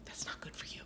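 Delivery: breathy unvoiced aside; whisper. Real, not acted. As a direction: whisper it like a secret. Teacher aside.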